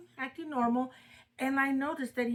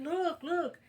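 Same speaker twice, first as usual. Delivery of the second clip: higher, insistent pleading, acted out; retold frantic urging; 7 semitones higher than usual. Mother imitating her son showing his arms, urgent and high. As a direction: imitate someone urgently demanding to be believed. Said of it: "screaming and yelling".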